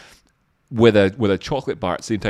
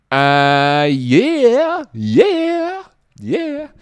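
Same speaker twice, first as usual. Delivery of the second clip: squeaky yeahs; 13 semitones higher, 5 dB louder, much slower than usual. Pretend. Mock-enthusiastic yeahs.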